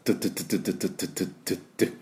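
fast percussive tongue drill; drill noise. Shown as a demonstration. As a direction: imitate a construction drill with quick d-d-d. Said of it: "工事現場のドリルの音です".

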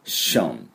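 ション starting on a clear hushing SH; SH-sound ending. Shown as a demonstration. Teacher showing the word's ending, hushing SH. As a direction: say ション as an English "shun" ending. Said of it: "シって音です".

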